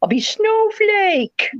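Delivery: put-on high, slow babyish voice as a pampered old lady; high cutesy voice; high little voice. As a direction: play the sweet old resident named Snowflake. Imagines herself as old resident.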